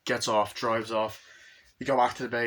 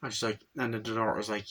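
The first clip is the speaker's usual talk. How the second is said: usual speaking voice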